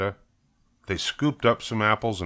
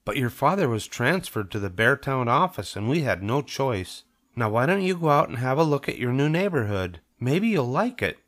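higher-pitched storybook mother; mother coaxing; 5 semitones higher than usual. Acted. Mother sends her child out to see the neighborhood, coaxing. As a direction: coax a child in a lifted voice. Mother persuades Miranda to explore.

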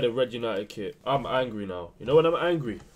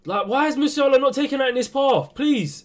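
exasperated complaint; 10 semitones higher, 5 dB louder than usual. Real, not acted. Gamer complaining about his player.

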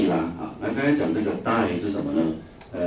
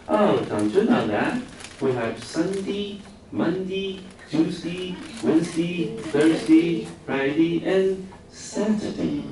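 put-on much higher, slow regional British accent, days said as Mundi, Tjusti, Wensdi; 10 semitones higher, much slower than usual. British teacher explaining to puzzled students, in regional British accent. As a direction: recite the weekdays in a high regional British accent. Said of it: "真的英国各地的腔非常多".

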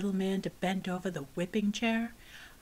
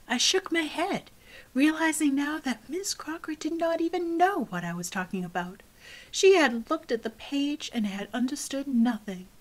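plain reading voice

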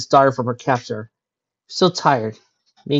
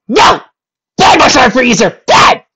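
very loud, high shriek of No; mock scolding; 12 semitones higher, 13 dB louder, slower than usual. Real, not acted. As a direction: scold it like a naughty dog. Reader scolding a pet.

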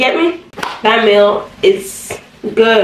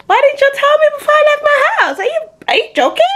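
high-pitched, incredulous protest; mock indignation; faster than usual. Customer hearing the toenail price went up, mock indignation. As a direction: protest in exaggerated disbelief.

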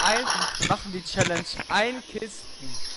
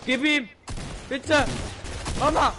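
higher, urgent game cries; excited shouts; 7 semitones higher, slower than usual. Real, not acted. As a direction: yell during the fight.

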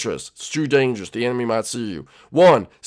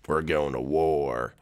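low, slow and much softer; grave war announcement; 12 semitones lower, 9 dB quieter, slower than usual. Acted. Dramatic mission announcement to recruits.

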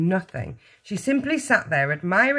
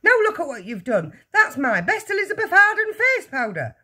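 high, loud shrieking complaint; scold, climbing into a screech; 12 semitones higher, 5 dB louder than usual. A narrator's reading. Mother laments her spilt face powder, shrieking. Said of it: "shrieked the mother".

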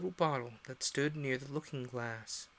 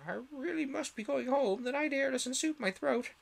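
much higher fussy bird voice; fussy old magpie; 12 semitones higher than usual. Acted. Old magpie excusing herself, high and fussy. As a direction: excuse yourself primly. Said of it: "one old magpie remarking".

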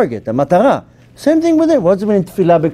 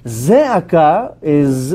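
Zeaka said very slowly and evenly; calm slow demo; much slower than usual. Shown as a demonstration. Teacher contrasting zeaka with tzeaka, said calm and slow. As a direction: draw out Zeaka slowly at normal level. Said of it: "two different words between tzeaka and zeaka".

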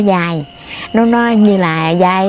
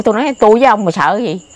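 ordinary talk, nothing marked

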